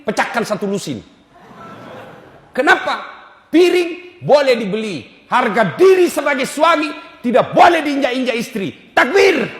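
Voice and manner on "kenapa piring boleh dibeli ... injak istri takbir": loud, high call to the crowd; rally them with a cry; rousing shout. Real, not acted. Preacher rouses the congregation to shout takbir, loud and high.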